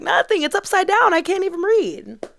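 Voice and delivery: high mock reply. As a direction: pitch it way up like a coy joke. Joking reply to onlookers.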